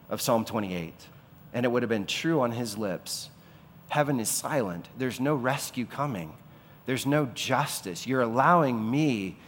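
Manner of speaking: normal talking voice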